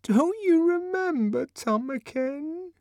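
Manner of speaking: very high plea, fading and drawn out; trembling voice. A narrator's reading. Linda anxious before the disgusted director, trembling. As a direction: plead anxiously as confidence drains away. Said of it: "repeated in a voice that trembled".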